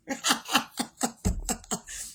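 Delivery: breathy amused laugh at her own joke. Real, not acted. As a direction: let a real laugh out.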